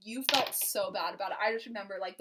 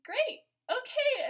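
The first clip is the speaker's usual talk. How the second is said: bright, much higher mock-eager okay; chirpy high agreement; 6 dB louder, much slower than usual. Retelling a date, she voices herself agreeing, high and eager. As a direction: squeak it out like a girl trying to sound game. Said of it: "And I was like".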